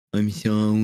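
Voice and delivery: slurred, pitiful apology; mock-pitiful mumble; put-on voice. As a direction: imitate a hurt, slurring gamer. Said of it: "he was just putting on a voice".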